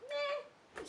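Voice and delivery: very high, very soft bored meh; can't-be-bothered meh; dismissive meh. Retold or imitated. Wander ignoring the troll, very high and soft.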